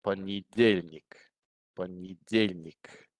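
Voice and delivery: понедельник slowly split into four beats, по-не-дель-ник; slow teacher's model of понедельник, beat by beat; syllable by syllable. Shown as a demonstration. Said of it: "you can hear there's four syllables".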